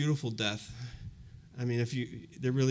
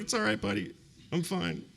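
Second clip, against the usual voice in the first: mock teary brush-off; 8 semitones higher than usual. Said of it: "Just crying".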